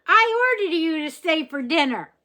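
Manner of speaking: put-on high, loud bossy child's command; very improper voice. A narrator's reading. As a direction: order the robot around like a cheeky little girl. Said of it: "In a very improper voice".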